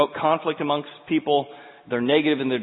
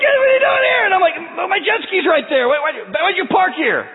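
high, loud shouted reply; yelling back; 7 dB louder than usual. Retold or imitated. Pastor shouting back at the fisherman, high and loud. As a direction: shout back over the engine noise.

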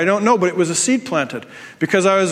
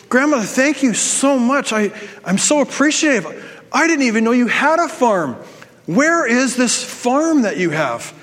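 blown-away granddaughter; 5 semitones higher than usual. Retold or imitated. Granddaughter thanking dying grandmother for inheritance, gushing in wonder. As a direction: act out an overwhelmed, grateful heir. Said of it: "this granddaughter is blown away".

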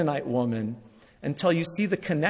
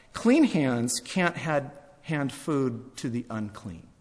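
slow, weighty delivery; spelling out the rule word by word; slow and deliberate; 2 semitones lower, 5 dB quieter, slower than usual. Acted. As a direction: lay out each word slowly. Preacher slowly spells out the rule.

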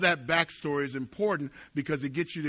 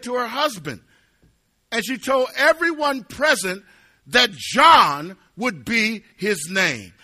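loud, raised preacher's voice; forceful preaching; 6 semitones higher, 7 dB louder than usual. Real, not acted. Preacher telling what Elizabeth knew, loud and insistent. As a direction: drive the story home with punched words.

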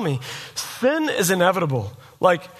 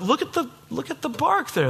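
eager tree guy; 8 semitones higher, 4 dB quieter than usual. Retold or imitated. Tree guy pointing at bark.

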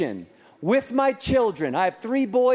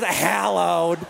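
mock know-it-all correcting "hallowed"; judgmental correction; 3 semitones lower than usual. Preacher mimicking a listener's correction, mock judgmental. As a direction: imitate a judgmental heckler, stretching the short a. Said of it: "such a judgmental tone".

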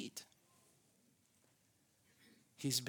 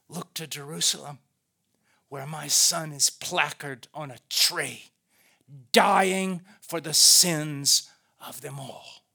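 slowing declaration, DYING hit loud; God's proclamation; 7 dB louder than usual. Acted. God points the accusers to the cross, solemnly emphatic. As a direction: proclaim it with heavy weight. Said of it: "He calls them to the throne and he goes".

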